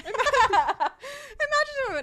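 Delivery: high, loud words through real laughter; breaking up laughing mid-sentence; laughing hard. Real, not acted. Teasing her co-host about allergies, cracking up.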